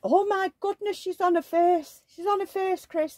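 high-pitched imitation of a shocked mum; retold shock in a high put-on voice; mock alarm. Retold alarmed mum spotting baby face-down.

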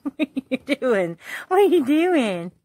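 high, slow pet voice; slow sing-song question at the rubbing dog. Real, not acted. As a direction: draw it out to the dog. Baby-talk to dog.

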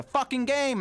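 high exasperated cry; frustrated outburst. Real, not acted. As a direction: let the frustration burst out high. Frustrated outburst mid-rant over the game.

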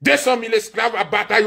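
normal talking voice

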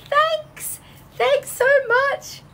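high, grateful voicing of a viewer; voicing Lily's thanks. Host voicing a viewer's thanks, high and grateful. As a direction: squeak out her thanks gratefully. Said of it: "Lily is saying".

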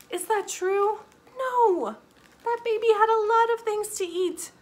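high, loud, playful protest; mock disbelief. Storyteller asking children if that's true, mock outraged. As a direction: exclaim in exaggerated disbelief to children.

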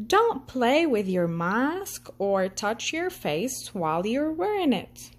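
ordinary delivery

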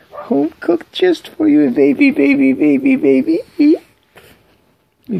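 squeaky singsong for a pet; high-pitched baby talk. Acted. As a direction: coo it way up high. Repeated phrase, high take.

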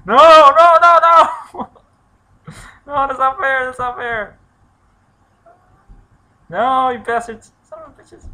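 high, loud desperate shouting; panicked yelling. Real, not acted. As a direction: yell in alarm as they close in. Zombies attack him.

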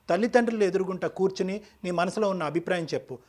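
usual speaking voice

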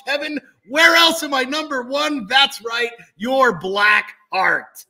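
booming wrestler-style boast; wrestling promo. Acted. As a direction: cut a promo to the crowd.

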